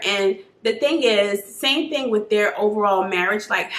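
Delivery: ordinary talk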